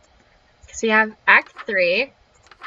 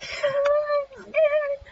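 high, laughing whimper of fear; laughing scared; 5 dB quieter than usual. Real, not acted. Player spooked by the vanished character, laughing whimper. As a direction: squeak it out, half laughing, half crying.

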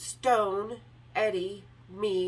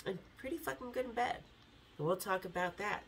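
much softer, quick, confiding aside; cheeky hushed aside; 3 semitones lower, 10 dB quieter than usual. Real, not acted. Narrator telling listeners about Eddie in bed, hushed cheeky aside. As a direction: drop it almost under your breath, fast.

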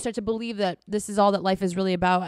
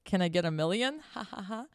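nervous joke; 5 dB quieter than usual. Retold or imitated. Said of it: "And I said".

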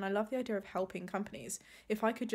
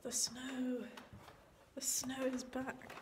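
whispering; 4 semitones higher, 8 dB quieter, slower than usual. Real, not acted. Noticing snow, hushed.

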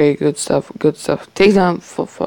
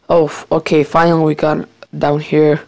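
ordinary talk, nothing marked

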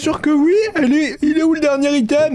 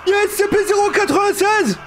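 higher, quicker alarmed announcement; alarmed cry; 6 semitones higher, faster than usual. Acted. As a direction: blurt it out in a high panic. Le monstre surgit dans le jeu. (cri d'alarme)

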